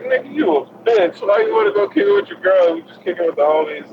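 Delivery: high, fast mocking jab at a friend; mock taunt. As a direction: tease him in a squeaky high voice. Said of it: "I'm like".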